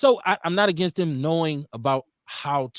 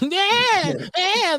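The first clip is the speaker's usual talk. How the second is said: mock baby cry; 4 dB louder than usual. Voicing baby son, very high.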